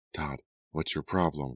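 ordinary delivery

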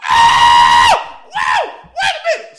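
huge high scream of alarm; mock-terrified shrieking shout; hammed-up panicked holler. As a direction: holler it at full blast. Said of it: "Everybody get to holler".

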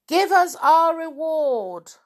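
high, loud, drawn-out shout; angry crowd demand. Acted.

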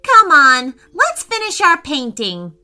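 rally a friend cheerfully; eager urging. Acted. Declan calls Preston back to painting, eager urging.

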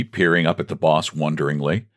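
ordinary delivery, nothing marked

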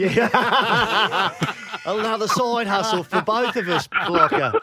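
amused laugh while answering. Real, not acted. Laughing at offer.

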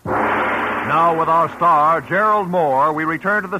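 high, bright announcer read over music; radio announcer. Acted. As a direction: present the show like an old radio host. Radio announcer introduces show.

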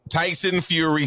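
usual speaking voice

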